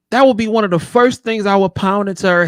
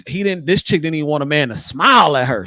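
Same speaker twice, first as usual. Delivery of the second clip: normal talking voice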